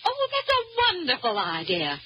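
high-pitched, gushing approval; delighted. Acted. Woman hearing of the contest idea, delighted.

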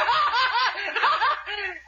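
high, manic forced laughter; hysterical laugh. Acted. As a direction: laugh yourself to death in despair. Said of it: "I'm laughing myself to death".

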